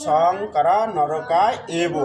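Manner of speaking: সংকারা নরকায় এব slowly, "sangkara norokaay ebo"; corrected pronunciation. Shown as a demonstration. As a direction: stretch সংকারা, keep এব separate. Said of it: "তাহলে এই উচ্চারণ কি হলো?".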